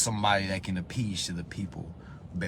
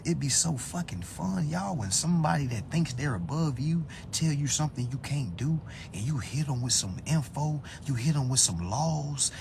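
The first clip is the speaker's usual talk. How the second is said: ordinary talk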